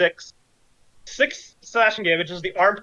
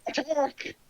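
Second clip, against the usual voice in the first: high, soft strained cry; faint little battle cry at a high pitch; muffled distant yell; 7 semitones higher, 8 dB quieter than usual. Acted. Game master repeats the enemy's order, faint high yell. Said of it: "he yells out".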